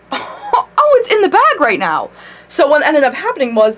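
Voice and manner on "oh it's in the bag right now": much higher, laughing through the words; giddy with glee, bursting into laughter; gleeful laughing. Retold or imitated. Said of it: "So I was like".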